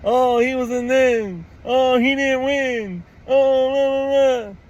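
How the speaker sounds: high, sneering mimic of online critics; mocking critics. Vlogger mocking fans who dismiss the signing, sneering. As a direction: parody the doubters dismissively. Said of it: "those that are talking".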